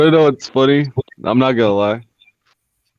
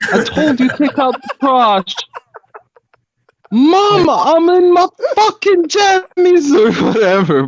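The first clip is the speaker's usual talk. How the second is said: mock British accent. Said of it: "mock British kid and mum".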